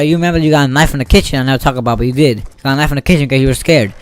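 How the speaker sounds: usual speaking voice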